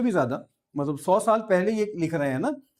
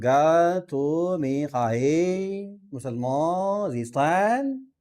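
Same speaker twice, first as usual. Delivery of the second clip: drawn-out Iranian Persian vowels, very slow; laboured Iranian Persian accent; much slower than usual. Shown as a demonstration. Teacher showing students how Iranians say Persian verse, laboured Iranian accent. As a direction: stretch each word in an effortful Iranian Persian accent. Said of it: "منہ ٹیڑھا کرنا پڑے گا تھوڑا سا".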